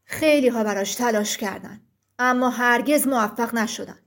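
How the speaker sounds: low, heavy giant voice; thick-voiced stone giant. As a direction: speak as a solemn rock giant. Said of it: "غول سنگی با صدای کلفت گفت". (صدای کلفت و بم غول سنگی)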